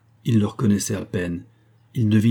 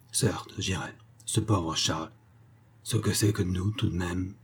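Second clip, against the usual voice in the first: softer, half to himself; murmured; 2 semitones lower, 7 dB quieter than usual. A narrator's reading. Georges murmuring to himself after reading the letter, soft and inward. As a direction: murmur it privately, almost under the breath. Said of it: "et il murmura".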